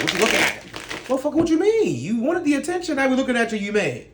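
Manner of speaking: loud, high, heated back-and-forth, acted out; imitating an angry attention seeker snapping back; acted confrontation. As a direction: play both sides of a street standoff. Acting out a confrontation over attention.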